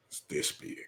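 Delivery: breathy whisper, no voice at all; whispered aside. Acted. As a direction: drop to a secret whisper on this big. Stressing how big the board is.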